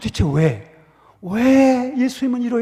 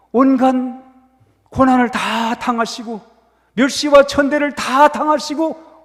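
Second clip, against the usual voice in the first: loud, driving sermon climax, louder; preaching at full force; 4 semitones higher, 7 dB louder than usual. Acted. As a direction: build the question with fervour.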